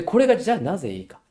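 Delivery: normal talking voice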